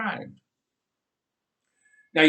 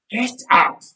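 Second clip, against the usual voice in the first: loud, curt order; grunted; 6 semitones higher, 7 dB louder than usual. A narrator's reading. Aunt ordering Nathan off the ground, curt. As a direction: bark it at the boy. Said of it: "grunted Aunt Rebecca".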